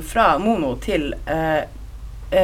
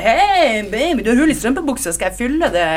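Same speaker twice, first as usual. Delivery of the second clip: 1 leering catcall; 5 semitones higher, 4 dB louder than usual. Retold or imitated. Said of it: "satt det tre norske ... og bare".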